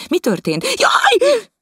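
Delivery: a high, piercing shriek. Acted. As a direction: shriek in alarm at the crash. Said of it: "sivított". (visító sikoly)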